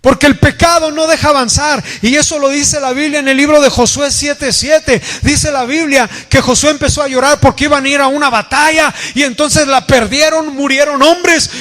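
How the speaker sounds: high, insistent preacher's delivery; full-force preaching. Real, not acted. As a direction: preach it urgently, pitched well above talk. (predicación enérgica, con voz alta y aguda)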